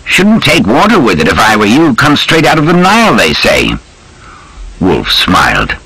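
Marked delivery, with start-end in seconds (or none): 0.1-3.7 lightly voiced chummy officer, higher pitched; British officer. Major advising a new drinking companion on Nile water, lightly voiced. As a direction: give a hint of a breezy army major.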